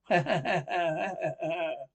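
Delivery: long staccato stage laugh; drawn-out ha-ha cackle; mocking laugh. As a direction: laugh at your own ghost joke. Heckler laughs at own spirit joke.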